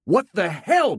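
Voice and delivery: sudden high, loud shout; angry parent yelling. Acted. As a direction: blurt it out at the kid. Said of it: "I shouted".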